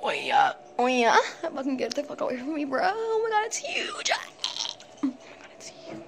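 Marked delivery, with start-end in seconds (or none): 2.8-4.1 scared squeal. Real, not acted. Shrieks at spider. 5.0-6.0 whisper. Real, not acted. Same words whispered right after.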